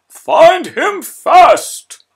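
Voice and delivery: very slow, loud, high command; cry out. A narrator's reading. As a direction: drag out the urgent order. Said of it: "cried the mayor".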